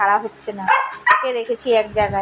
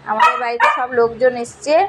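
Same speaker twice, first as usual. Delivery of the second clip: usual speaking voice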